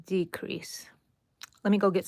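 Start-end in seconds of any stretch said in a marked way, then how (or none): 0.0-0.9 whisper. Real, not acted. Whispering while stitching.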